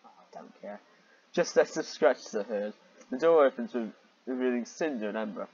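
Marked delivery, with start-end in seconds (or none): 0.1-0.8 a soft, whispered "I don't care"; whispered shrug. Real, not acted. Reader gives up on the plot, whispering to himself. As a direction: breathe it out, giving up on it.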